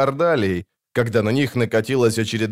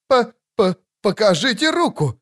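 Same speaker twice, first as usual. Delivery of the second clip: stammering, higher, panicky babble; stammering priest; 9 semitones higher than usual. A narrator's reading. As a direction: stumble over the first syllable in fright. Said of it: "забормотал жрец".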